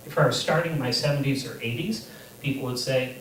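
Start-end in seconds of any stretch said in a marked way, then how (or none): none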